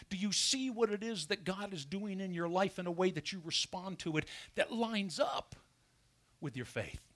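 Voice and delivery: ordinary delivery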